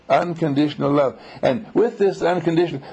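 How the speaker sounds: ordinary talk, nothing marked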